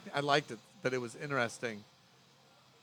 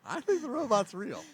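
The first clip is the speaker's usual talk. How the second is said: put-on child voice; 11 semitones higher than usual. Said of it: "He's like".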